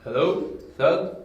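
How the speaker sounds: calling out. Acted. Boss looking for the missing henchman, calling out. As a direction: call out loudly for someone who should answer.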